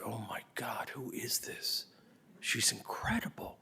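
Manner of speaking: hushed, stunned director; amazed whisper. Retold or imitated. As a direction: whisper it like you have found a star. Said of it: "and he said".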